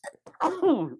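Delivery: sudden loud sneezing cough; a sharp high sneeze. Real, not acted. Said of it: "I'm gonna sneeze in a minute".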